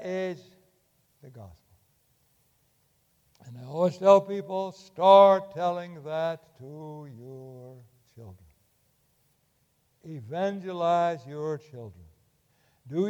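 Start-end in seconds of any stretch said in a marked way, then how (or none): 4.7-8.4 drawn-out, louder, each word pressed; slow insistent urging. Real, not acted. Preacher urging parents to teach children, slow and pressing. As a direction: press every word, slowly.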